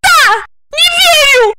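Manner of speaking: very high, loud female squeal; piercing angry shriek. A narrator's reading. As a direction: screech it back at him. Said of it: "завизжала Ольга".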